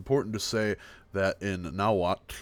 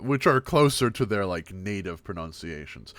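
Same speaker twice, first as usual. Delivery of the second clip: ordinary talk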